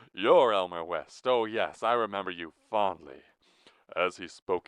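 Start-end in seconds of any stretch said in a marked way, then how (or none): 0.1-3.2 loud, every syllable of "remember you fondly" stressed equally. A narrator's reading. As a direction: punch each syllable of "You're Elmer West" evenly and loudly. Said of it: "he stressed every syllable of every word equally".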